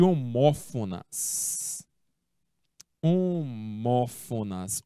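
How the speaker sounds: "homófonas" drawn out slowly, every syllable clear; slow model word. Shown as a demonstration. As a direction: say "homófonas" slowly for the class to repeat. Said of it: "teacher models the new word". (pronúncia lenta e clara de "homófonas")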